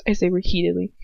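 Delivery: ordinary delivery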